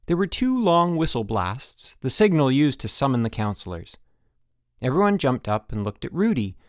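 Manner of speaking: ordinary delivery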